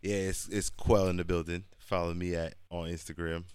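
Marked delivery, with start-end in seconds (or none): none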